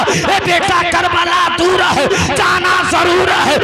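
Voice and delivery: loud, high, ringing declaration; fervent preaching proclamation. Acted. Preacher voicing Husain's words to his son, loud and high. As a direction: proclaim it to the whole crowd. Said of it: "Hussain ... अपने बेटे अली अकबर से कहा था".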